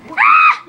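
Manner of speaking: very high, loud yelp; startled scream. Acted. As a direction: shriek at a fake explosion. Scream after fake explosion.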